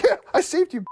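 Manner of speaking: high, smug boast; mock self-congratulating voice; proud Mac. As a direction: brag like a clueless hero. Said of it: "He's like".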